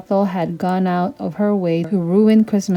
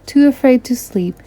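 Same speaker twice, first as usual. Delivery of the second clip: usual speaking voice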